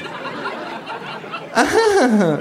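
high, long mocking laugh; kangaroo laugh. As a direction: laugh along, kangaroo style. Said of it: "lacht auch das Känguru".

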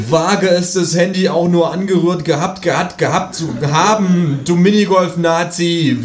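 hammed-up threat; mock shout. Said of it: "würde ich geschrien gehabt". (gespielt lauter, drohender Schrei)

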